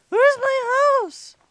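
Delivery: high, loud, stretched "Where's my house?"; frantic kid yell. Pretend. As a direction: holler it like a lost little kid. Lost, repeats the question louder.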